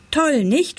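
put-on high, eager boy's question; proud enthusiasm. A narrator's reading. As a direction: show it off, fishing for praise. Proud boy seeks father's praise. (stolz und begeistert gefragt)

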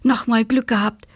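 mildly relieved beetle papa. A narrator's reading. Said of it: "freute sich der Marienkäfer Papa".

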